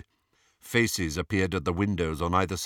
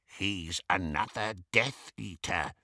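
soft goblin undertone; breathed warning; 2 semitones lower, 7 dB quieter than usual. A narrator's reading. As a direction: breathe it secretly into an ear. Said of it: "breathed Griphook".

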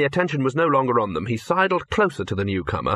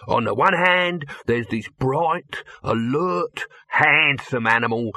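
brash hustling producer; 3 semitones higher than usual. Acted. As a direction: sell the idea eagerly, pitch riding up.

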